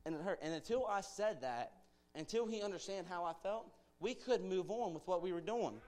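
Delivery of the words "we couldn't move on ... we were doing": ordinary delivery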